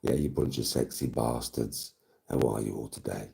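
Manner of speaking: low, soft, playful seduction; hammed-up sultry greeting; mock seductive. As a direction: drop low and quiet, teasing the listeners.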